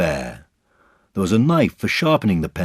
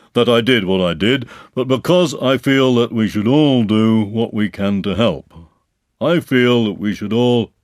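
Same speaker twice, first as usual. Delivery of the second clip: pompous donkey orator, higher and louder; Eeyore; 5 semitones higher, 6 dB louder than usual. Acted. Eeyore resuming his speech to the party, pompous.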